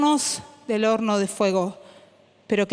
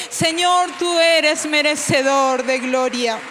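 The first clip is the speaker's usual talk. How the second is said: fervent worship prayer; 5 semitones higher than usual. Acted. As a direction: cry out praise with full force. Leads worship prayer with music.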